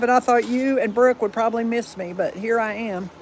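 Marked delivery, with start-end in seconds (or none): none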